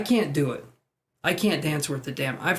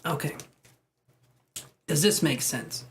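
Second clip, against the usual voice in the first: normal talking voice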